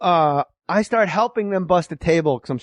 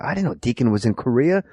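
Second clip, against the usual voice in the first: ordinary delivery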